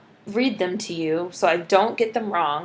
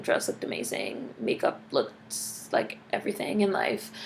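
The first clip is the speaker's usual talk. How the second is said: whispered; 10 semitones lower, 10 dB quieter than usual. Real, not acted. Gushing aside.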